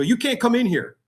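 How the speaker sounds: high, sharp receptionist's refusal; receptionist blocking. Retold or imitated. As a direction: stop him at the door, high and firm. Receptionist stops homeless applicant.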